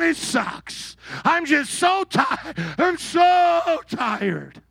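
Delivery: slow, high whiny mock of so tired; mock whine. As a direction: drag it out like a worn-out complainer. Mocking tired complainer.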